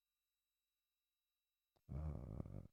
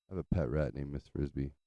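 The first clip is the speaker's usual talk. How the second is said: ordinary talk, nothing marked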